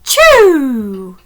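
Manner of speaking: loud explosive sneeze; a huge comic achoo; big sneeze. Acted. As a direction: sneeze hard. Miss Brewer sneezes.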